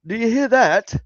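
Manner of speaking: high, loud British caricature; mock British accent. Mocking British people hearing a noise, high British accent. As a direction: exclaim it as an exaggerated Brit. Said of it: "British people are like".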